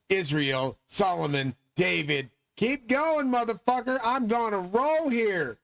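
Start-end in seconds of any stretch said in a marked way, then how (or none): 2.6-5.6 high, hyped self-cheer, slowing at the end; pumped up. Real, not acted. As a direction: psych yourself up to keep rolling. Hyping himself through names.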